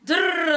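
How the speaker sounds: loud high rolling drrr; fire sound effect. Retold or imitated. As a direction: make the whoosh of fire starting. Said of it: "my drrr means fires".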